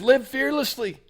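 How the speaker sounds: a light put-on sweet husband voice quoting the wrapper; husband's quote. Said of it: "And he said".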